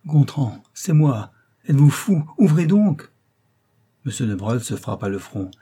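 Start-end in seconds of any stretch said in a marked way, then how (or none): 0.1-3.1 high, loud and rising call through the door; woman crying out. A narrator's reading. The viscountess shouts through the library door, loud and high. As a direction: call out higher and louder with each phrase. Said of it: "Elle criait".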